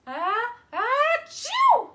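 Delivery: building ah-ah into a loud, high achoo; big bear sneeze. Acted. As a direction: wind up the sneeze and let it burst. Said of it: "and then I sneeze".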